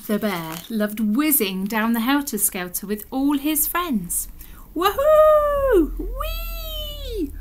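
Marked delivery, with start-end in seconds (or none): 4.7-7.3 high, drawn-out squeals of glee; gleeful whooping. Acted. Bear whizzing down the helter-skelter, gleeful whooping. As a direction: whoop all the way down the slide.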